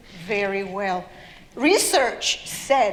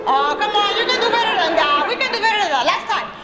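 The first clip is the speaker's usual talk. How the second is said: high, loud, quick rallying call; rally the crowd for more; egging on; 7 semitones higher, 4 dB louder, faster than usual. Real, not acted. Storyteller prods the audience to chant better, egging on.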